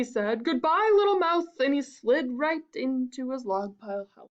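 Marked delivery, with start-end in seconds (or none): 0.4-1.5 higher-pitched hasty farewell; mildly panicked goodbye; alarmed snake. Acted. Snake flees from the Gruffalo.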